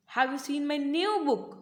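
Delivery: slow question peaking on NEW; stress on NEW. Shown as a demonstration. Teacher demonstrating how stress changes meaning, stress on NEW. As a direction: draw out and lift the word new. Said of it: "repeated with different words".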